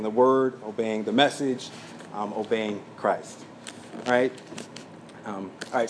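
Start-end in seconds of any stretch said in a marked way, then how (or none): none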